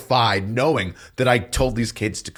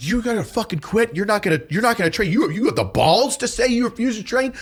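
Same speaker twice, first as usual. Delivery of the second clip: raging berate; 13 semitones higher than usual. Retold or imitated. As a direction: scream down at the trainees. E7 berates trainees at rage level.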